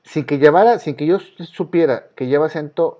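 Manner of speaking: normal talking voice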